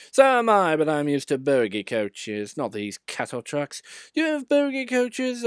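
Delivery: high, loud proud voice; boastful engine. A narrator's reading.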